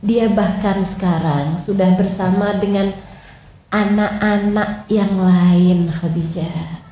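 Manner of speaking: soft, slow, low reassurance; tender consolation. Acted. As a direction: gently console a weeping wife. Prophet consoles Khadijah.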